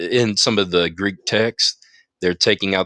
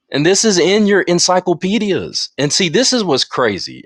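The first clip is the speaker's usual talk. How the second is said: excited; 10 semitones higher, 5 dB louder than usual. Real, not acted. Excited point about encyclopedias.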